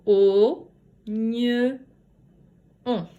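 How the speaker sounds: "O, gne, on" in three separate sounds, O loud and held. Shown as a demonstration. Teacher recapping three sounds, "O, gne, on" firmly separated. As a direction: sound out oignon with gaps. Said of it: "Trois sons".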